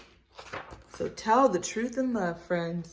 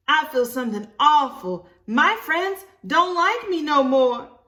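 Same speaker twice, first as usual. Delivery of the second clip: put-on a little girl pouring out her trouble; upset child voice; 7 semitones higher, 9 dB louder than usual. Libby complaining to Mama about her friends, in a high whiny voice.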